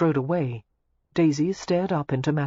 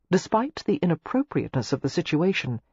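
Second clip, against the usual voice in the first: usual speaking voice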